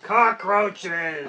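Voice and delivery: loud joking shout. Acted. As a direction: blurt it out to shock.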